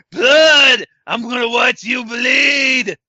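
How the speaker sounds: slow, loud, dragging zombie growl. Retold or imitated. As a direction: lurch through it like a zombie. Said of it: "Sounds more like a zombie".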